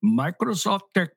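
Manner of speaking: mock Indian scam caller accent. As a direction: put on an Indian call center accent. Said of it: "just for the freaking Indian accent".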